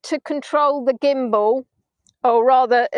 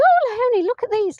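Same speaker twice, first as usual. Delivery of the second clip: high, swooping exclamation; excited sister; 11 semitones higher than usual. Retold or imitated. Sister pointing out boards in the pub, excited. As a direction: squeal it like an eager discovery. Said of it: "She said".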